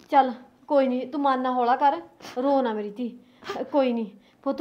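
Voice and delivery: mother gently comforting her crying daughter; soft comforting. Acted. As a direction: soothe her to lie down.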